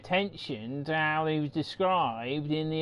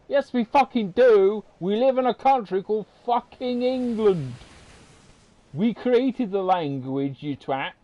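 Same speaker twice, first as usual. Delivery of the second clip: mock angry retort; 5 semitones higher, 5 dB louder than usual. Arguing with imagined American.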